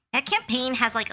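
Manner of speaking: normal talking voice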